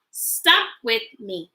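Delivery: ordinary delivery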